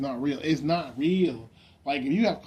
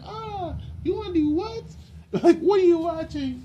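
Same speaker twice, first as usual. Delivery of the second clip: very high squeal, edging into laughter; mock shocked reaction; 14 semitones higher, 3 dB louder than usual. "my reaction" to men, high mock-shocked squeal. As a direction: squeal it in hammed-up disbelief. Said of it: "but you be like".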